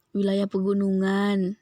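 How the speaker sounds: ordinary talk, nothing marked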